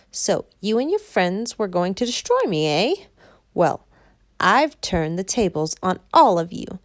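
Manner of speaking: sneering triumphant witch; wicked witch voice. Acted. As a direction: witch gloating over captives.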